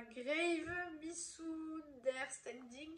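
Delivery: English name in a French accent, slowly; poor English accent. Real, not acted. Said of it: "Mon accent anglais nul".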